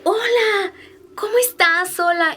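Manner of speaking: put-on a young man overjoyed to see her again; eager greeting. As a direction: greet her like an old friend, thrilled.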